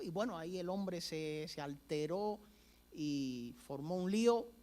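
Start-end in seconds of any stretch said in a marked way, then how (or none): none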